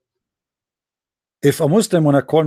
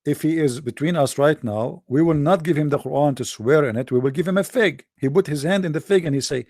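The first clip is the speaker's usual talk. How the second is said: ordinary talk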